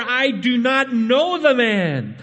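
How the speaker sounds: high, loud, slow oath; Peter swearing denial. Acted. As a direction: swear it out desperately. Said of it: "he swore".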